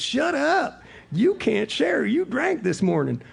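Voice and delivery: put-on high, loud cranky old-timer voice; scolding old timer. Old timer telling off a biker at a meeting, high angry yell. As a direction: imitate an old man yelling someone down. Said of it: "This scrawny little Florida old timer".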